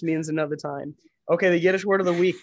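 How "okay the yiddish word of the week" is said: segment announcer. Acted.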